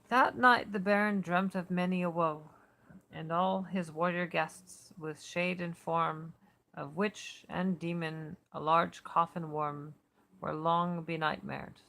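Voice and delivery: measured, slow reading of a gothic verse; poem reading. Acted. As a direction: read it like an old poem to an audience. Reads a Keats epigraph.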